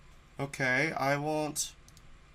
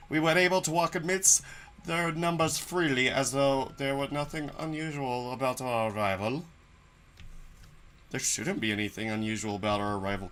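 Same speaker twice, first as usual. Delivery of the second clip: usual speaking voice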